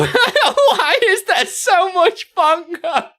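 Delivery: high, loud and slow through laughter; helpless real laughter. Real, not acted. Streamer baffled by bongo spam, helplessly laughing. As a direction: crack up while asking in disbelief. Losing it laughing at spammed emotes.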